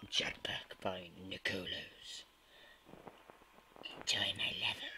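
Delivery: whispered title read, quick and breathy; level reading whisper. Acted. Gamer whispering the level description to viewers.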